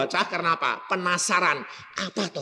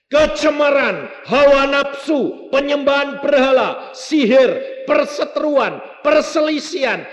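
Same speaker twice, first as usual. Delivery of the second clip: loud, high sermon list; a preacher thundering the works of the flesh; fiery preaching; 12 semitones higher, 8 dB louder than usual. Acted. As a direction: hammer each sin at the congregation. Shouts list of sins.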